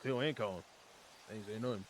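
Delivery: put-on a loose Cajun accent, lightly done. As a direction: play a swamp man with a Cajun accent. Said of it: "stop doing the accent".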